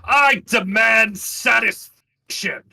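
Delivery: an affronted noble's formal challenge; haughty challenge. Acted. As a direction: demand it like an offended noble. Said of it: "slaps him backhanded".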